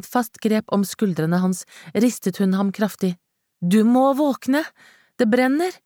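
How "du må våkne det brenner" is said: high, slow, pleading cry; terrified, slowed cry, much higher; frantic plea. A narrator's reading. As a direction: drag out each word in terror. Shaking him awake in fire.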